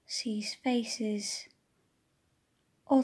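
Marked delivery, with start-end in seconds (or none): none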